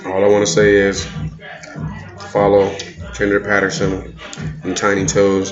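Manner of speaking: ordinary delivery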